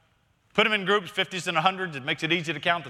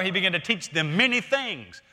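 normal talking voice

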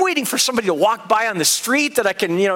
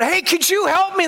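shouting out; 9 semitones higher than usual. Retold or imitated. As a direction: call down for help, retold. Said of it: "I shouted out".

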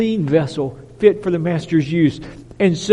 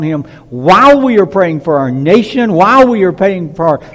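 rising pulpit thunder; fiery preaching; 3 semitones higher, 6 dB louder than usual. Acted. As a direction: preach it out with full conviction. Preaching at full force.

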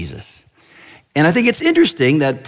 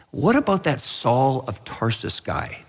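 softer, laid-back musing; understated suggestion; 6 dB quieter than usual. Acted. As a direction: float the idea quietly and casually. Jesus leans back, casually suggests Saul.

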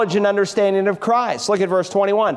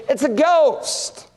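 frightened cry; 6 semitones higher, much slower than usual. Acted. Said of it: "they were frightened".

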